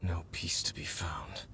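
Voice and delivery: soft unvoiced whisper, wistful; whispered lament. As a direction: breathe the words almost to yourself. Quiet lament over a dandelion.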